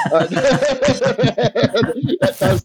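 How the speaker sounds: slow, high laughing through the words. Real, not acted. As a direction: break up laughing mid-word. Laughing at his own line.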